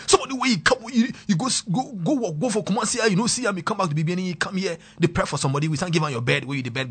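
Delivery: high loud hammed-up scolding; mock yelling at the tenant; retold angry rant. Retelling a scolding host, loud and high. Retold landlord shouting about the bed.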